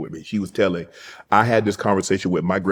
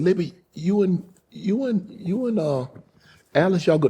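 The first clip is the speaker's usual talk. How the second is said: much higher, a put-on frail grandma; old grandmother's voice; 10 semitones higher than usual. Grandmother sending the family home, high put-on voice. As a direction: lift the pitch and play the old granny.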